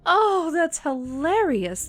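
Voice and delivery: high, drawn-out amused condescension; slow, lifted, amused delivery; mocking amusement. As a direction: savour the joke at their expense. Vampire amused by wish to turn.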